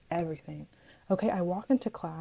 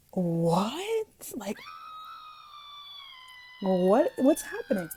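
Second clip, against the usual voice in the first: drawn-out rising mock shock, then higher; stunned disbelief; slower than usual. Girl hearing the rumour in another class, acting stunned. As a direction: stretch each "what" into a baffled question.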